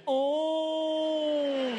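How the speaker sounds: high, drawn-out startled "Oh"; involuntary ghostly moan. Retold or imitated. Recalling the exam, a high ghostly "Oh". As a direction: let "Oh" escape like a trapped ghost. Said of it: "this was the actual sound I made".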